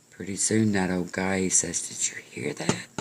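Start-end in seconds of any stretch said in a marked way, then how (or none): 1.9-2.9 high, whispered question; hushed alarm. Acted. Old man hears noise, alarmed.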